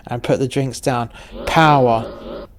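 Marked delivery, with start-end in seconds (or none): 1.5-2.5 drawn-out rallying shout, moderately raised; band's shout. A narrator's reading. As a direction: call it out like a band chanting at a drinker. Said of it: "the rest of the band shouted".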